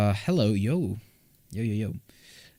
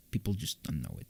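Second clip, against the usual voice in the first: normal talking voice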